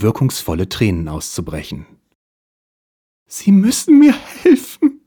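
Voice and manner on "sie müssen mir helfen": much higher, louder hammed-up wail; theatrical crocodile-tear plea; fake sobbing. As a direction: fake tears to win him over. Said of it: "schluchzte sie ihn schließlich an".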